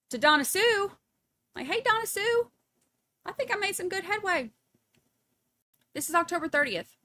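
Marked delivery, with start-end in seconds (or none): 1.7-4.5 high-pitched put-on cheery report; mock chipper son. Son reporting back to Donna Sue, mock chipper and high. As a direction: imitate an eager son, pitched up.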